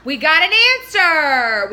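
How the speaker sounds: slow, loud announcement of victory; mock triumph. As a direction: crow it out loud. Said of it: "I'm like".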